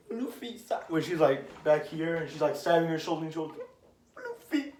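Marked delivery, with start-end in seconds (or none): 0.1-0.8 soft, high little voice; small high mock plea cut short; pleading imitation. Imitating Nami asking Luffy for help. 4.2-4.7 very high, soft squeak of a plea; thin mock-tearful squeal; pleading imitation. Imitating Nami calling Luffy in tears.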